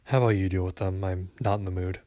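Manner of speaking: low grumbled refusal; grumbling. A narrator's reading. Coworker handing off customers to a colleague, grumbling low. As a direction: push the job away in a low grumble. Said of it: "my coworker grumbled".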